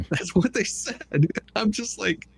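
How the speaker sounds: talking through a laugh. Real, not acted. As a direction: laugh while you say it. Laughing after mock quote.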